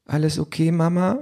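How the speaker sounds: put-on high, rising small-child question; little girl's voice. A narrator's reading. Three-year-old checks on her mother after quarrel, childlike. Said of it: "kommt die dreijährige Isabel".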